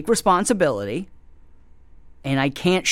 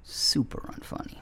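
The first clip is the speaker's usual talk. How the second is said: muttered aside; 10 dB quieter than usual. Real, not acted. Muttered aside after rant.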